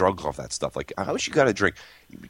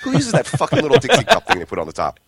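laughing; 7 semitones higher, 5 dB louder than usual. Real, not acted. Laughing rant.

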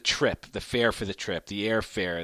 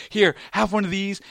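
pleading dad; 8 semitones higher, 4 dB louder than usual. Retold or imitated. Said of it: "I was like".

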